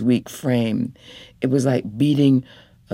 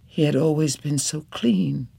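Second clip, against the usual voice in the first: plain reading voice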